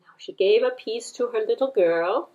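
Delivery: usual speaking voice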